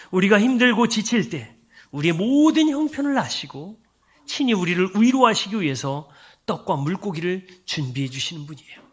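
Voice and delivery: usual speaking voice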